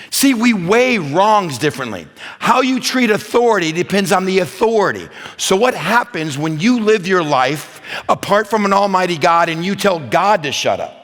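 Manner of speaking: high, loud sermon delivery; forceful preaching. Real, not acted. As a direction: drive the point home to the congregation.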